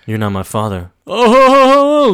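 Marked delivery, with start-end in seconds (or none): 1.0-2.1 villain surprise. Acted.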